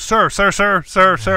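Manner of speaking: nervous worker begging to calm him; pleading usher. Retold or imitated. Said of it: "he goes".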